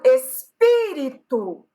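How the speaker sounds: ESPÍRITO slowly, PÍ long and punched; stress on PÍ. Shown as a demonstration. Teacher showing another example, espírito with drawn-out, stronger PÍ. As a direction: hit and stretch the PÍ in espírito. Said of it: "a sílaba tônica é a antepenúltima".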